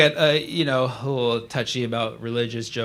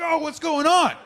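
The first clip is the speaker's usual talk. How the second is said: high, startled cry; mock bewilderment; 13 semitones higher, 4 dB louder than usual. Socked from behind at a party, startled bewilderment. Acted shock after being hit.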